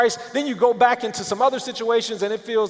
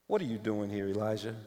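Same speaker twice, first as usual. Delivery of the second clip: gentle voice of God; 10 semitones lower, 12 dB quieter, faster than usual. Acted. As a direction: ask it as a tender murmur. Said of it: "a voice said to him".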